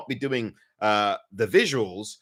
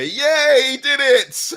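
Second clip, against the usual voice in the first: excited cheer; 11 semitones higher, 8 dB louder, slower than usual. Real, not acted. Cheers own success.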